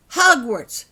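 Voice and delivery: loud, pointed; insistent call; firm repeat. A narrator's reading. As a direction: say it again into his eyes. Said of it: "repeated Holly".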